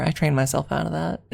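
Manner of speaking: ordinary delivery, nothing marked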